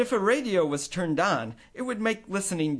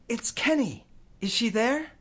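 ordinary delivery, nothing marked

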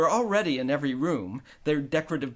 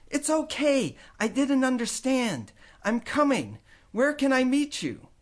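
put-on higher eager boy voice; eager boy reassuring mom; young boy; 10 semitones higher than usual.

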